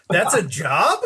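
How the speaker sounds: retold incredulous question, lifting at the end; surprised question. Storyteller quoting his reaction to the marketing chief, mildly incredulous. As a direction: ask it in mild disbelief.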